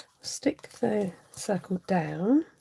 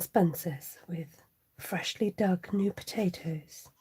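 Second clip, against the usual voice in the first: very slow, lower, half-whispered luxury food-ad read; M&S advert voice; 3 semitones lower, slower than usual. Retold or imitated. Telling viewers her dinner, in the Marks and Spencer's ad voice. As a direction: seduce them with each ingredient like a posh ad. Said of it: "I've got to say it in the Marks and Spencer's voice".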